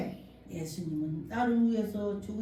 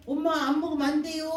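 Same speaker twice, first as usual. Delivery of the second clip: higher and louder, begging her to eat; pleading call; 6 semitones higher, 6 dB louder, faster than usual. Retold or imitated. Child begging old mother to eat, loud and high. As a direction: urge mother to eat, raised voice.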